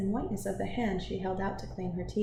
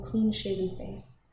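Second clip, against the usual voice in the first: plain reading voice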